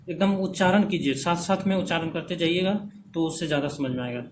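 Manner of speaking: ordinary talk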